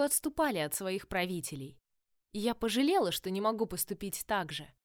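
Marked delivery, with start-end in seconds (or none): none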